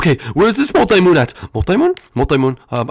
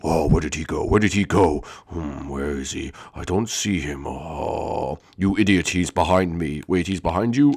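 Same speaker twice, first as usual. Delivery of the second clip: very low, soft, puzzled rumble; low bickering giant limbs; deep dim-witted monster; 9 dB quieter than usual. Acted. Boss limbs searching for Mario.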